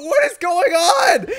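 slow, high, loud laughing disbelief; high laughing outcry at the stab; shocked cry. Real, not acted. Shocked at game violence.

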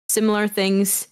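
ordinary delivery

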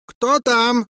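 high, loud call through the door; drowsy prince calling out; sleepy prince. A narrator's reading. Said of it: "zapytał rozespany Bulbo". (zaspany książę woła zza drzwi)

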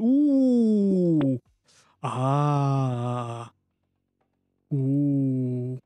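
long sustained vocal oohs and ahs; mock interlude music. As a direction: hum out a fake musical interlude. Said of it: "plays interlude mu".

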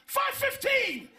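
high, slow, loud shout; cashier shouting. Retold or imitated. Cashier snapping the time back, slow high shout. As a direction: bark the time out, dragged high.

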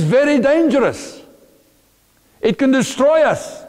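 high-pitched, forceful sermon delivery; impassioned preaching. Real, not acted. Preacher warning the congregation that knowledge can destroy, impassioned preaching.

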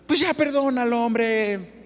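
high, offhand mock brush-off; breezy dismissive advice. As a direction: toss it off lightly, like it costs nothing. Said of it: "así como con mucha ligereza".

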